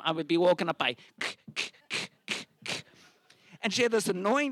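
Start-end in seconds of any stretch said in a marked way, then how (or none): 1.6-2.8 voiceless tongue clicks for heels; imitated heel clicks. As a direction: click softly like heels on wood. Imitating high heels at night.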